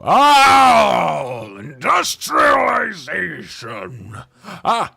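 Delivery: loud, stretched-out cry of delight; excited shout. Real, not acted. Streamer cheering a game milestone, loud and slow. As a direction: belt it out slowly, thrilled.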